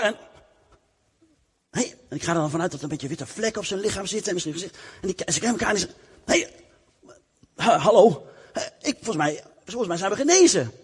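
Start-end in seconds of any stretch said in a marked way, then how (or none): 6.2-10.7 surprised. Acted. Lepers discover they are healed.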